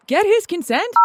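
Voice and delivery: calling after her. Acted. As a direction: shout the question at someone already walking out. Host calling after departing caller.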